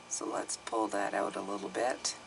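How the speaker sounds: soft unvoiced whisper. Real, not acted. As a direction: whisper it to yourself. Quietly handling the cards.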